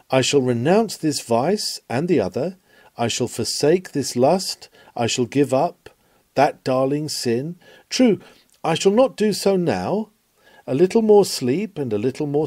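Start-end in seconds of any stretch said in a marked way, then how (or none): none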